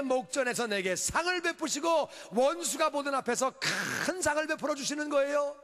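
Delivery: high-energy sermon delivery; impassioned preaching. Real, not acted. Pastor urging congregation to believe God protects, impassioned preaching. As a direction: preach it out with ringing conviction.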